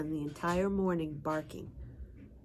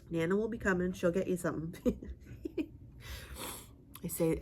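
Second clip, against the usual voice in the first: ordinary talk, nothing marked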